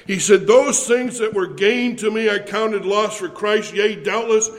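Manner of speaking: loud building preacher cadence, hammering each "all"; rising sermon climax, loud and insistent; preaching at full force. Acted. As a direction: preach it, driving the list home.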